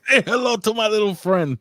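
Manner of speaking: Scarface line, loud and high; Al Pacino impression. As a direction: growl it like Tony Montana. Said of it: "Al Pacino".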